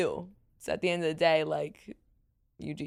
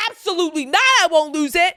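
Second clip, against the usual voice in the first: loud, high retold protest; emphatic refusal; 9 semitones higher, 10 dB louder, faster than usual. Host retells answering girls on the hike, emphatic refusal. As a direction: reject it outright, raised voice.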